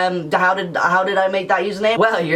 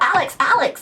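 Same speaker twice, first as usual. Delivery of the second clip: high playground call; 12 semitones higher than usual. Retold or imitated. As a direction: shout the name like kids chasing. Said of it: "chase me and be like".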